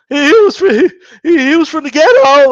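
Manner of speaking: mock sobbing stammer, high and loud; hammed-up tearful announcer, breaking down; Jim Lampley. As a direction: imitate someone bursting into tears mid-sentence. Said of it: "He's gonna start crying".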